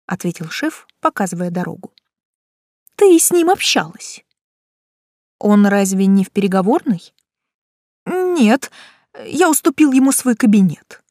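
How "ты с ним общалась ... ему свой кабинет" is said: rushed, worried manager; flustered boss. A narrator's reading.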